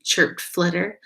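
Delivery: usual speaking voice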